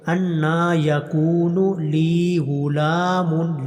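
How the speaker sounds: slow drawn-out tajweed chant; slow tarteel recitation; Quran recitation. Shown as a demonstration. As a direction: recite each vowel long and measured. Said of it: "لی لام کو لمبا کرنا ہے".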